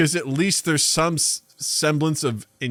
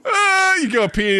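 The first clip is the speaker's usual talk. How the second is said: mock crying; 3 dB louder than usual. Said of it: "starts going".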